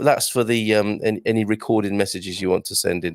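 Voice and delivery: ordinary talk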